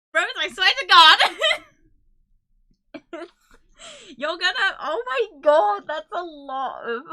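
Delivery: loud, high, laughing protest at a huge gift; overwhelmed disbelief. Real, not acted. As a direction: cry out in shock, half laughing, half tearful. Overwhelmed by a large gifted donation.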